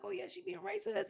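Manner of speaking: very high-pitched mocking voice; squeaky pretend yes-man; mock agreement. Imagined gullible agreement, voiced mockingly.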